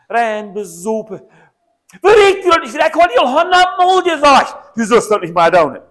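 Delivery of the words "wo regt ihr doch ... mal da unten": high, loud exasperated scolding; loud, high, slowed-down reprimand; scolding shout. Acted. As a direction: yell it like a fed-up parent who has said it a hundred times. Acted scolding of someone.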